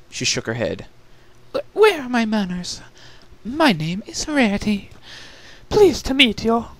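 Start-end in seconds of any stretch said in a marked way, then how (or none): 1.5-6.7 high, prim filly introducing herself; flustered but ladylike; polite Rarity. A narrator's reading. Rarity greeting her cellmate, flustered and polite.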